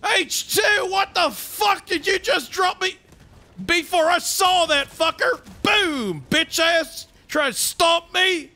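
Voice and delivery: barking army sergeant, high and loud; Sarge. Acted. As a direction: shout it like a sergeant under fire. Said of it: "Sarge is actually just one of them".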